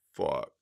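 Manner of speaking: long sigh into a breathy, barely voiced curse; exasperated sigh. Real, not acted. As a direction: let the air out, defeated. Inmate asks for bed move.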